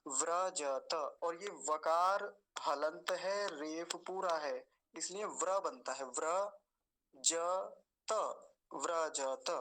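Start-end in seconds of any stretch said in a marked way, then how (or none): 0.1-0.9 व्रजत as vra-ja-ta, vowels complete; full syllables. Shown as a demonstration. Teacher reminding students out of duty, full syllables. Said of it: "तो मैं बता देता हूँ". 6.1-9.5 व्रजत in parts, vra, ja, ta; full syllables. Shown as a demonstration. Teacher building the word from व्र, full syllables. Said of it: "ऐसा बोलेंगे".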